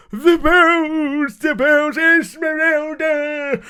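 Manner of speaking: high, loud, drawn-out wail; Quasimodo. Acted. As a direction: cry it out slowly like a tormented bell-ringer. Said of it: "you had all your Quasimodos".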